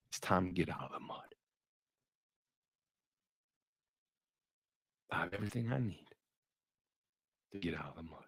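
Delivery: low, soft, slowing down to near silence; hushed emotional telling. Acted. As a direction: let it fade into a hush.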